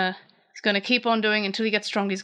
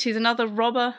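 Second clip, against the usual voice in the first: ordinary talk, nothing marked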